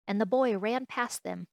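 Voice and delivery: plain reading voice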